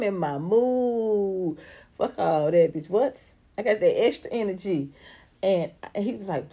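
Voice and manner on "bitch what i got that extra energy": ordinary talk, nothing marked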